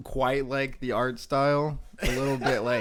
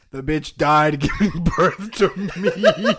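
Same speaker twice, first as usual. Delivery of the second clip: reads through laughter; words broken by laughs; laughing; 3 semitones higher, 7 dB louder, slower than usual. Real, not acted. Laughs while reading premise.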